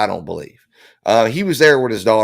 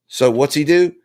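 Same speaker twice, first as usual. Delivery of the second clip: usual speaking voice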